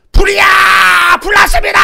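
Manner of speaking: high, drawn-out yell of alarm; full-volume cry of fire; urgent fire-alarm shout. Shown as a demonstration. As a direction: scream a warning to everyone. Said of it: "어떻게 불났다라고 소리를 지릅니까?".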